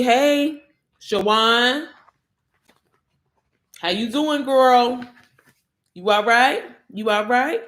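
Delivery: imitating a friend checking in; friendly check-in.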